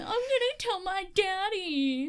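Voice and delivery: put-on high childish tattling whine; mock spoiled brat. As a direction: threaten like a crybaby running to daddy. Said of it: "you're doing that really well".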